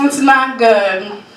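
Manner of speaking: slow repeat at ordinary pitch; understated warning. Acted. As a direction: repeat it slowly, bringing it down. Quieter repeat of the punchline.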